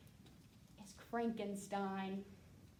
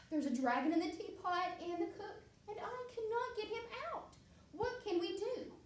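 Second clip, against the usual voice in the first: high fretting cook; 8 semitones higher than usual. Acted. Cook pleading to princess.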